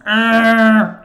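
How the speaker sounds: loud, held "arr" through bitten teeth; long, loud angry growl; teeth-clenched growl. Shown as a demonstration. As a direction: growl angrily with teeth together. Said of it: "bite their teeth together and go".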